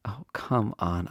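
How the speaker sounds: usual speaking voice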